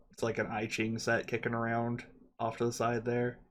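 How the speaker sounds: ordinary talk, nothing marked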